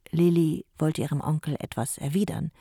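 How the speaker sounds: ordinary delivery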